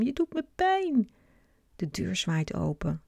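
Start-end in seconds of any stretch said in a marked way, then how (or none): 0.0-1.1 high, hurt cry; a child crying out that it hurts; hurt shriek. A narrator's reading. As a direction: complain sharply, still in pain. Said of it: "gilt Sam".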